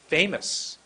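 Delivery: FAMOUS pushed out strongly; big intonation. Shown as a demonstration. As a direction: launch FAMOUS with force. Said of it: "How about if I go like this".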